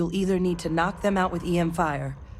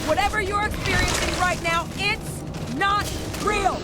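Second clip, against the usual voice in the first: high, pleading shout; urgent shout; 12 semitones higher than usual. Acted. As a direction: snap him out of it. Captain hallucinating.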